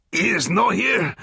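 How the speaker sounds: very high, choked plea; hanged alcalde insisting in broken English; strangled answer. A narrator's reading. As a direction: squeeze the words out.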